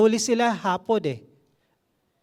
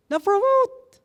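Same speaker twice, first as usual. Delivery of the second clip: mock indignation; 13 semitones higher, 3 dB louder, slower than usual. Mocking coworker's undeserved promotion.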